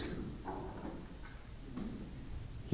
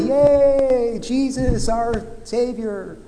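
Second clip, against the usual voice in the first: much higher, sing-song mock celebration; mock cheer; 7 semitones higher, much slower than usual. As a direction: cheer like a cheesy greeting card. Mock Hallmark party cheer.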